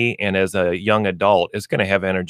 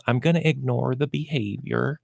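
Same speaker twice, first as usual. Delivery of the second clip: soft, higher-pitched feigned indifference; mock ignoring; 4 semitones higher, 6 dB quieter than usual. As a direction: imitate an owner pointedly ignoring it, softly. Voicing owners who pretend nothing happens.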